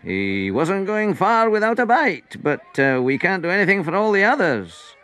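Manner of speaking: high, jolly voice; good-humoured, lifted delivery; lightly amused disciple. A narrator's reading. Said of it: "laughed Andrew".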